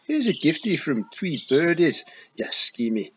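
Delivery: loud, high Scottish taunt at the cat; a tiny warrior bellowing an insult; loud Scots feegle jeering at the pussy cat; yelling Scottish feegle. Acted. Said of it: "he yelled".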